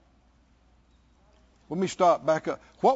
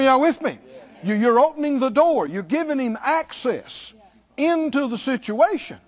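ordinary talk

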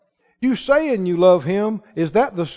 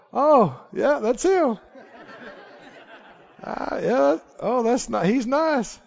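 higher, softer, half-laughing mock approval; mock underwhelmed reaction; 4 semitones higher, 5 dB quieter than usual. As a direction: play a mildly impressed onlooker, lightly. Imagined underwhelmed reaction to seeing Jesus.

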